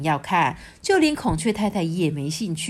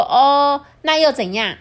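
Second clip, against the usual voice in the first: louder, higher shrugging reply; unimpressed crow; 7 semitones higher, 7 dB louder, slower than usual. A narrator's reading. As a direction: brush it off. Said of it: "小乌鸦就说". (满不在乎)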